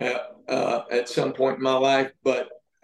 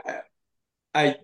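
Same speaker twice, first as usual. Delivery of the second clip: ordinary delivery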